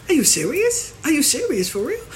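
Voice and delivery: high incredulous disbelief; are you kidding me, rising. Retold or imitated. Disbelieving reaction to costly ritual.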